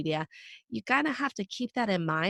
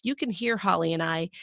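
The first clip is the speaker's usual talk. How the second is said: ordinary talk, nothing marked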